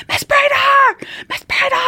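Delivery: high, loud yell across the hall; retold scream for help. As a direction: call the colleague in a panicked shout. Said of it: "I'm screaming for my colleague".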